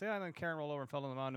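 rattled off quickly and offhand; fast casual retelling. Retold or imitated. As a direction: breeze through it like it's nothing. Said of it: "he just glazed right past".

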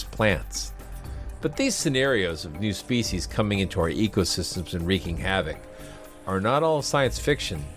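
measured narrator voice over music; documentary narration. Acted. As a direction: read it like a nature documentary. Narrated podcast intro over music.

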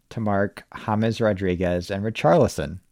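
ordinary delivery

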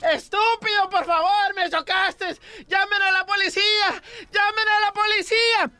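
very high, hammed-up outcry. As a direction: wail for help in a high comic voice.

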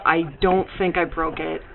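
usual speaking voice

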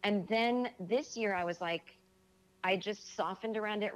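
usual speaking voice

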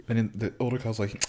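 ordinary talk, nothing marked

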